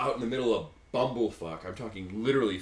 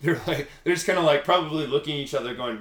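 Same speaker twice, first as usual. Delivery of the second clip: ordinary talk, nothing marked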